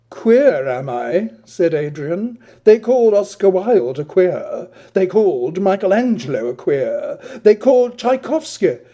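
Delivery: haughty retort. Acted. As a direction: declaim it with wounded pride. Adrian retorts grandly.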